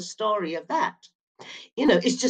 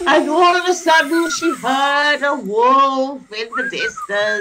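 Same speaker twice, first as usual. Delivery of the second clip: slow, louder, higher story narration; storytelling voice; 6 semitones higher, 5 dB louder, slower than usual. Acted. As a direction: draw the class into the tale slowly. Teacher tells the story slowly.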